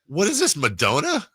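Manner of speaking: very high, mock-indignant questions; baffled old-timer. Old-timer confronted with eighties pop stars, mock baffled. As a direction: squawk in put-on bafflement.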